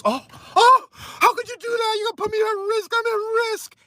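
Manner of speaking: very high-pitched mock panic; mock whining. Mocking the opponent's complaint about being put at risk, mock whining. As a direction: wail it in a high, whiny mock victim voice. Mocks her complaint at protest.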